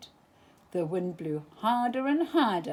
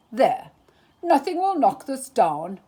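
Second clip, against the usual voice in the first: louder, pleased with himself; proud Eeyore; 3 semitones higher, 8 dB louder than usual. A narrator's reading. Eeyore admiring his new stick house, proud and loud. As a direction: announce it proudly and loudly. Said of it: "Eeyore said proudly".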